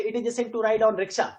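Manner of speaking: the wrong way, all words joined and unstressed; run together, no stress. Shown as a demonstration. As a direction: read straight through without pauses. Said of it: "अगर ऐसे एक साथ पढ़ेंगे".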